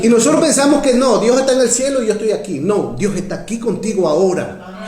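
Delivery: high, forceful pulpit declaration; fervent preaching. Real, not acted. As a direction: preach it with rising conviction.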